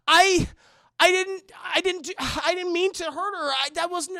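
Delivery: high, loud, faltering; panicked stammer. Retold or imitated. Wife stammering to husband she didn't mean it, high. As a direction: stumble over the words in distress.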